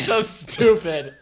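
drawn-out laughing words; slow and giggling through it. Real, not acted. Laughing at armadillo joke.

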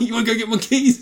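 high, coaxing pet command; dog owner. Retold or imitated. Owner asking the dog to fetch keys, coaxing high.

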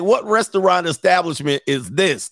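ordinary talk, nothing marked

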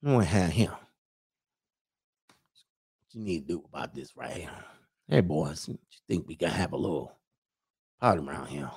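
low, hushed Mississippi local with words run together; real quiet Mississippi accent. Acted. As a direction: keep it very soft and low, Mississippi style. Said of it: "they real quiet".

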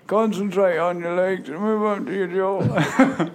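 high, slow, mumbly rocker impression; slurred slow rocker voice; [name]. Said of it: "an attempt at [name]".

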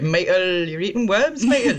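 put-on playful Sunderland accent with a laugh. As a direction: say it broad Sunderland, as a kitchen joke. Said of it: "that line in a Sunderland accent".

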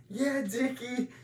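high, slow mock call back; calling across party. Dad answers Dickie across the party, calling out. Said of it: "Why are they shouting across the party?".

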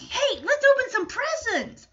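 high-pitched, excited call to everyone; rally the room like an impatient kid; eager kid excitement. Retold or imitated. Acting out child eager for presents.

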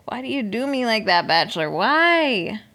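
plaintive complaint building to a loud why; mock lament. Fan pleading with the show like an ex, mock-wounded. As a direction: wail the final word in mock hurt.

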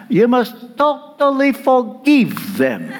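fond imitation of a Romanian friend's accent. Preacher imitating his Romanian friend, in a Romanian accent. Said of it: "I can hear him now in his Romanian accent".

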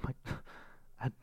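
soft, badly faked Croatian accent. Podcaster imitating a surprised Croatian greeter, bad Croatian accent. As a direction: attempt a Croatian accent, softly and haltingly. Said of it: "That's not even a Croatian accent".

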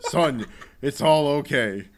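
loud, drawn-out parody of a mother; mock reassuring mother. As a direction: play a hammed-up mom consoling her son. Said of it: "She's like".

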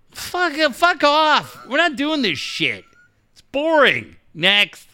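high, drawn-out opening, then curt brush-off; mock dismissive rejection. Co-host acting out a rejection of a show idea, mock dismissive. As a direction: heckle the idea away in exaggerated boredom.

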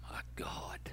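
hushed, barely voiced aside; shocked mutter. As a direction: imitate a listener under your breath. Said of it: "some of you are going".

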